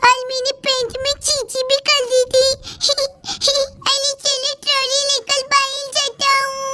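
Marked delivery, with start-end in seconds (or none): 2.5-3.9 sneaky giggle. Pretend. Child giggles at getting away.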